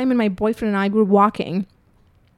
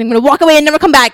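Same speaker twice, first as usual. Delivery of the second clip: angry ultimatum; 4 semitones higher, 7 dB louder, much faster than usual. Retold or imitated. Boyfriend's retold threat.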